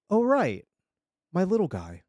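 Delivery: sudden pleased recognition, pitch jumping up; brightened. A narrator's reading. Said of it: "She brightened".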